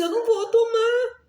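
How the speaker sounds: tearful refusal. Retold or imitated. Refusing the injection, crying.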